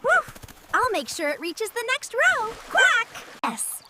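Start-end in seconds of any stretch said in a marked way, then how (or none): 0.0-0.4 two quick barks, much higher; dog barks. Acted. 2.7-3.1 a loud quack, much higher; excited quack. Acted. Duck character quacks.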